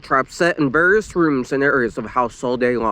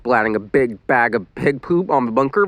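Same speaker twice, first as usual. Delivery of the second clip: plain reading voice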